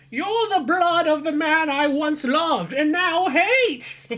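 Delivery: high, slow, hammed-up witchy lady; melodramatic old woman. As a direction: ham it up like a scorned villainess. Agatha's melodramatic line.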